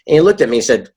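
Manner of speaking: usual speaking voice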